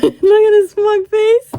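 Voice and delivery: high, drawn-out fangirl squeal; excited gushing. Real, not acted. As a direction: squeal it slowly in delight. Gushing at a character's face.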